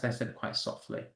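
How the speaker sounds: hushed, almost whispered; said softly. Shown as a demonstration. Teacher showing how unstressed words sound, very softly. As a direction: let the voice fall away to a breath of sound. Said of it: "That's why I'm talking like that".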